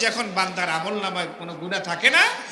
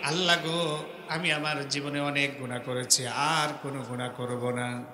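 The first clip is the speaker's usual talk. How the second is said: pleading prayer; 6 semitones lower, 8 dB quieter than usual. Acted. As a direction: beg God like a helpless sinner, quietly. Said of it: "এই ওয়াদাটা করতে হবে". (নরম নিচু গলায় কাতর মোনাজাত)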